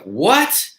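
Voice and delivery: disbelief. Retold or imitated. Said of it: "now I lost it".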